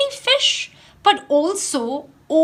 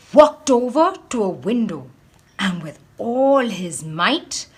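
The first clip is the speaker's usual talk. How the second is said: plain reading voice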